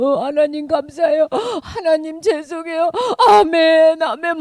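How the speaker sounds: gasping, tearful prayer, acted out; mock weeping. People praying to God as they weep, acted sobbing. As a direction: imitate a sobbing worshipper. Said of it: "막 이러면서 우는 거야".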